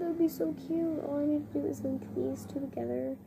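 usual speaking voice

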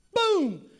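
high, loud burst; exclaimed reveal. Retold or imitated. Young son reveals his surprise to dad, loud exclamation. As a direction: punch it out suddenly like a magician's reveal. Acts out son's proud reveal.

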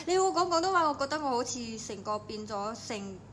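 normal talking voice

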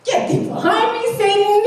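hammed-up rebuke of Satan; mock rebuke. Mock rebuke of God's word.

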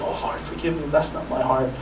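soft, hushed plea to the Lord; quiet, humble confession; repentant prayer. Retold or imitated. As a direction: murmur a sorry prayer to God. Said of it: "repent and say".

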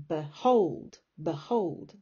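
beHOLD, with hold punched; stress on HOLD. Shown as a demonstration. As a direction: swallow be, land hard on hold in behold. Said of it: "the stress is on hold".

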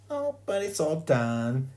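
high, slow, drawn-out objection; pleading schoolgirl. A narrator's reading. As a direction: plead as a girl defending her work. Sheila defends her cover.

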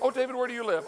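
high-pitched playful question; mock admirer. Host imagining someone asking his address, high-pitched. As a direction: pitch it up as someone making small talk.

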